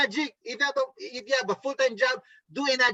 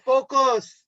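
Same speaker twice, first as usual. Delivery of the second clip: loud, raised one-word order; emphatic self-command; 4 semitones higher, 6 dB louder than usual. Shown as a demonstration. Coach telling sellers what to tell themselves, emphatic. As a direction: drive it home like a motivational command. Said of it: "Say to yourself".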